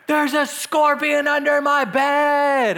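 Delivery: high, drawn-out hammed-up panic with a gasp; mock-terrified high wail, words stretched out; panicked scream. Imitating a panicked roommate who found a scorpion, hammed-up panic. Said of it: "all I hear from him is".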